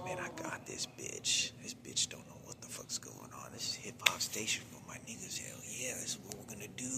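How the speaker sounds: hushed, breathless whisper of plotting voices. Retold or imitated. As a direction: whisper it like scheming voices in her head. Imagined voices in mother's head.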